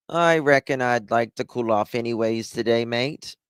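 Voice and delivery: a calm mock Aussie, no drama at all; lightly done Australian accent, totally relaxed; laid-back Australian accent. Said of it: "Australians are the least dramatic people".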